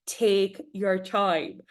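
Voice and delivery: slow and deliberate advice. Shown as a demonstration. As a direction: draw out take your time. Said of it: "my other advice".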